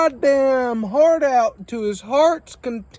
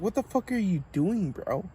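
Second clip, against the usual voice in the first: muttered retort; 10 dB quieter than usual. Retold or imitated. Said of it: "I was like".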